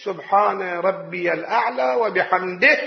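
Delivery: unhurried, each word complete; slow full glorification. Shown as a demonstration. Preacher showing the proper prostration prayer, slow. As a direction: say it out fully and slowly. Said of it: "لا أقل التسبيحة الواحدة".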